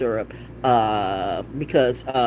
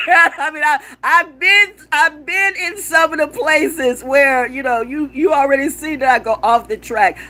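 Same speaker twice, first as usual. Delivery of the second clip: much higher, louder, slow laughing talk; 12 semitones higher, 6 dB louder, slower than usual. Real, not acted. Laughing to viewers about roaming the woods.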